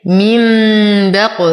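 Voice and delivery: من بق with ن as a hummed "m"; iqlab with ghunna. Shown as a demonstration. Teacher showing iqlab, long nasal "m" in من بق. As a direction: hold "mimmm" in من, then bounce the ق of بق. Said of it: "فالحكم إقلاب مع الغنة".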